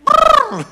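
piercing, loud brrr; imitated uncontrolled trill. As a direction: imitate an overexcited high trill. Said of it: "kinda lost control of herself".

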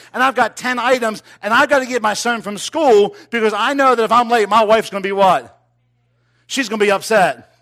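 full-throated revival preaching; preaching at full force. Acted. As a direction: drive the story home to the congregation.

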